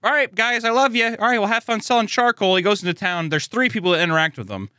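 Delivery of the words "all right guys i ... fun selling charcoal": high, loud, chirpy send-off; cheery family farewell. Retold or imitated. Anime family waving off charcoal seller, mock-cheerful. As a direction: send them off with exaggerated cheer.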